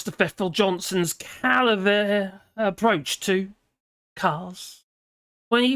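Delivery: mock-grand essay reading, deliberately phrased; measured delivery with drawn-out key phrases; scripted read. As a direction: read it out like a presenter delivering a column. Reads out a prepared script.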